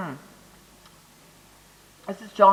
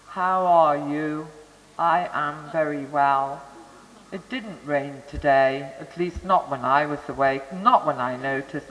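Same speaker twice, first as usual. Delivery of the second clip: flat monotone; 5 semitones lower than usual. Shown as a demonstration. Lecturer imitating right-hemisphere damaged speech, flat monotone. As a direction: drone every word on a single pitch. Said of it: "it's all just one tone".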